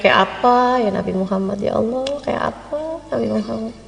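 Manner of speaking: low, softening inner voice; murmured longing, pitched low; yearning musing. Acted. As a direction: think aloud softly, trailing away in longing.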